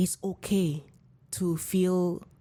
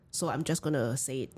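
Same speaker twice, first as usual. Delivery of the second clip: normal talking voice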